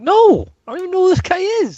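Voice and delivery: high baffled outburst; exasperated. Retold or imitated.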